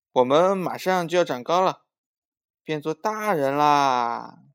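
excited announcement. Acted. Songsong telling mother they'll be grown-ups, excited and loud. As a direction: proclaim it loudly, stretching the end.

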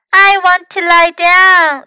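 high, loud little-one voice; small child's voice. A narrator's reading. Hurt Louis orders Fox about, high small voice. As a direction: make demands in a high small voice.

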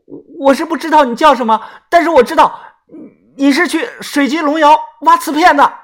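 a desperate woman shouts his secret aloud; loud shout. A narrator's reading. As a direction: blurt it out for the street to hear. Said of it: "大声地喊".

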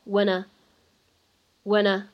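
"when" slowly linked into the next a; linked reading. Shown as a demonstration. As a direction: link the n of "when" to "a". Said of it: "又可以带一个连读". (慢速连读 when)

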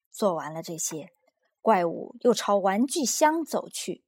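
ordinary delivery

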